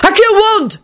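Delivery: high, sharp summoning cry; shouted spell. A narrator's reading. Neville summoning his wand back, shouted. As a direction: call the spell out with force. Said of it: "Neville shouted".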